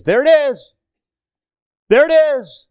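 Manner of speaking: high, loud proclamation; excited exclamation. Real, not acted. Preacher to congregation, loud excited cry. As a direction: ring it out.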